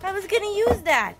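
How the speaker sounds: high, loud mock outrage; surprised scold. Real, not acted. To Ruby the dog, loud high shocked scolding. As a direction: cry out in shocked dismay.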